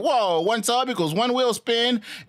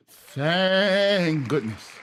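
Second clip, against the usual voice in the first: relieved; 2 semitones lower, much slower than usual. Real, not acted. Relieved at easy exercise.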